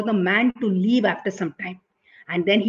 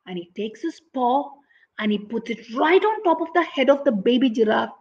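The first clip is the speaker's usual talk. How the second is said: ordinary delivery, nothing marked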